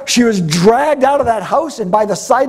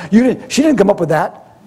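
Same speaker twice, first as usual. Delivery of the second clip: ordinary talk, nothing marked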